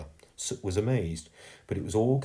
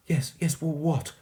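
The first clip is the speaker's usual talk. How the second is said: put-on high, urgent child's plea; pleading; 9 semitones higher than usual. A narrator's reading. Girl pressing the fading ghost, pleading. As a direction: beg him to say it. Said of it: "pleaded Stella".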